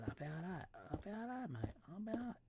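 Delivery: high, soft squeaky reassurance; put-on high voice. Player approves the building, high silly voice. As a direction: pitch it right up, playfully.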